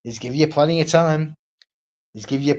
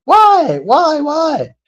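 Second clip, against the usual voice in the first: put-on very high, louder child's whine of why; insistent child; 13 semitones higher, 7 dB louder, much slower than usual. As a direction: keep pestering, louder each time. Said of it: "And when you give them the answer".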